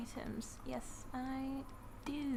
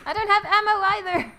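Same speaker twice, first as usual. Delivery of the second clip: loud, higher, exasperated; exasperated shout; 8 semitones higher, 10 dB louder than usual. Real, not acted. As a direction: cry out at the game. Out of ammo in a fight.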